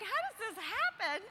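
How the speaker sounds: high, incredulous exclamation; surprised. Retold or imitated. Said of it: "I'm like".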